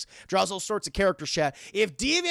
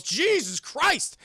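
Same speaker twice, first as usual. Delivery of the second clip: drawn-out, higher exclamation; acted shock; 6 semitones higher, much slower than usual. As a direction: stretch out a startled oath. Acted shock at nearly bitten finger.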